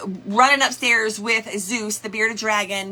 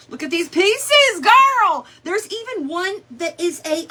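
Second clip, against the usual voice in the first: excited; 7 semitones higher, 3 dB louder than usual. Real, not acted. Excited over fabric pieces.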